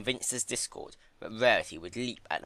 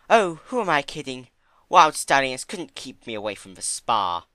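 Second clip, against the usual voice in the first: higher, louder, delighted; dreamy Rarity; 5 semitones higher, 8 dB louder than usual. Acted. Rarity daydreaming to Fluttershy, higher and delighted. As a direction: savor the luxury.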